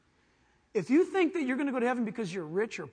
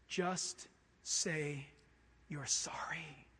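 lower, soft whisper of a plea; hushed plea; 5 semitones lower, 7 dB quieter, much slower than usual. Acted. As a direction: breathe it like a secret hope. God pleading softly.

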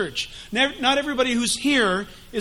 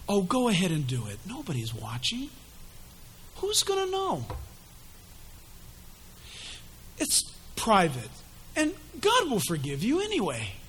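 soft, coaxing tempter; hushed sly devil murmuring temptation; Satan's tempting voice; 3 semitones lower, 8 dB quieter than usual. Acted. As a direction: purr it like a sly seducer. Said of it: "you'll recognize his voice in a moment".

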